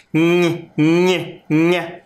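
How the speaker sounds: nhe repeated three times as a pure sound. Shown as a demonstration. Teacher showing the sound of NH. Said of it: "Que som que ele faz?".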